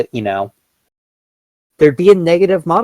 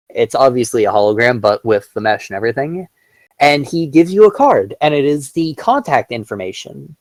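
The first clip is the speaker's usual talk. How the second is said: ordinary talk, nothing marked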